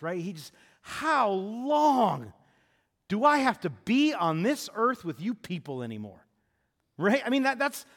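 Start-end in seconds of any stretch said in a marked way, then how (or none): 0.8-6.2 drawn-out, loud, pained "How long", pitched high; Jesus groaning at the people, loud and weary; exasperated Jesus. Retold or imitated. Jesus to the crowd, exasperated loud complaint. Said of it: "What in the world is his response".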